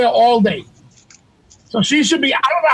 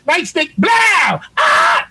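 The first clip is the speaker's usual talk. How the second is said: a shouted [name] yelp; [name] scream; 4 semitones higher, slower than usual. Retold or imitated. Mocking the beating, he screams like [name]. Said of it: "Now he sound like [name]".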